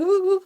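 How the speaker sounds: high wobbly "woo woo"; shaking washing-machine noise. Retold or imitated. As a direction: imitate juddering uncontrollably. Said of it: "shaking like a, a washing machine".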